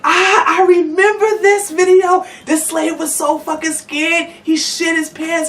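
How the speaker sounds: high, mocking voice for her old fangirl posts; her own old retweets. To her followers, mocking her old self with a high, gushing voice. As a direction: send up your past self gushing. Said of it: "to my followers like".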